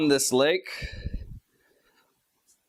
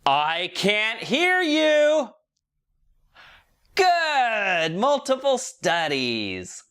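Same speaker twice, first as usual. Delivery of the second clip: put-on much higher, slow and drawn out, egging the kids on; kids show host; 9 semitones higher, much slower than usual. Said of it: "one of them interactive kids shows".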